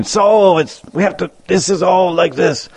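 high, put-on lecturing voice; lecturing teacher. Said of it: "Murari Gupta saying".

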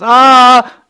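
sudden loud high wail; piercing cry of longing; anguished cry. Acted. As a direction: let out a sharp burst of anguish. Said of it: "He's crying".

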